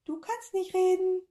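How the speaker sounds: tiny creature mimicking the professor; squeaky echo. A narrator's reading. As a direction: squeak it right back. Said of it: "antwortete die Kugel".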